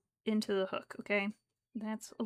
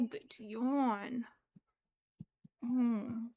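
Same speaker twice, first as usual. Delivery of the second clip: usual speaking voice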